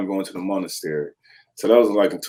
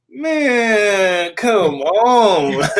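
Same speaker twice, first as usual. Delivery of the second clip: exasperated plea; 10 semitones higher, much slower than usual. Retold or imitated. Talking to the pillow.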